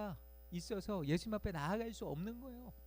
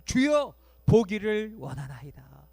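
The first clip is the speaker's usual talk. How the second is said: loud plea; 3 semitones higher, 11 dB louder, slower than usual. Pretend. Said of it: "바디메오가 뭐라고 대답해요?".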